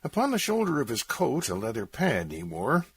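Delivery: ordinary delivery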